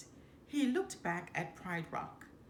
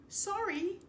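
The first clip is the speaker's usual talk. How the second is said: mild holler; 11 semitones higher than usual. A narrator's reading. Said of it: "he hollered".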